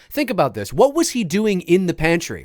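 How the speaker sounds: ordinary talk, nothing marked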